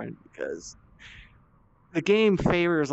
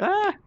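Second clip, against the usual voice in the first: startled yelp. Retold or imitated. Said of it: "I'm like".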